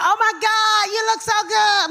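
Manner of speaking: high, held-breath phony squeal; fake Hollywood gushing. Imitating fake people in Hollywood, high breathless gush. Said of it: "That's how the people be in Hollywood".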